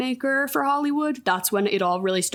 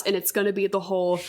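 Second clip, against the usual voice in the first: ordinary talk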